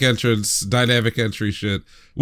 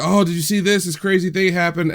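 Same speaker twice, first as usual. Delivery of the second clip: higher-pitched, lightly hammed-up excitement; mock excited gossip; 7 semitones higher than usual. Imagined viewer sharing a crazy clip, mock excited. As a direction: gush like an imagined fan. Voicing excited social media chatter.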